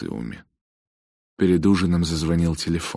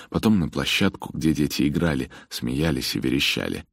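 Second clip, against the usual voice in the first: plain reading voice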